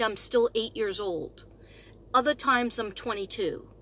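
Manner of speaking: ordinary talk, nothing marked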